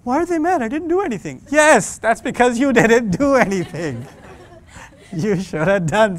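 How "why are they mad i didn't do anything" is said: put-on higher, baffled kid voice; confused teen. Teen puzzled why parents are mad, higher. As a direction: sound honestly puzzled. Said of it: "you literally did nothing".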